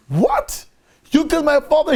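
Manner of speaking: very high-pitched outraged cry; shrieky mock outrage, only moderately loud; retold screaming. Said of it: "here is yelling and screaming".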